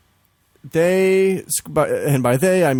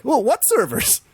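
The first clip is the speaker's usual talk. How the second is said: high-pitched mock cluelessness; mock clueless server; 11 semitones higher than usual. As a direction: play dumb in a much higher voice. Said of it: "they're like".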